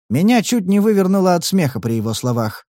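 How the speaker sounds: ordinary delivery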